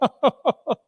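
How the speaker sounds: a short high laugh; light laugh. A narrator's reading. Missus Cratchit laughing at the last scrap. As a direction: let a small laugh out. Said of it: "Missus Cratchit laughed".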